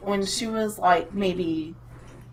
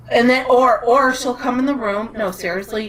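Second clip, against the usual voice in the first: ordinary talk, nothing marked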